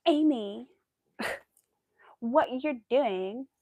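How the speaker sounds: loud, high, slow mock mother; imitating mom. Quoting her mother's voicemail, loud mock nag. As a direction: drawl the mother's nagging.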